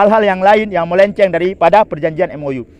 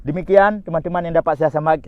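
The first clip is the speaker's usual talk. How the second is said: usual speaking voice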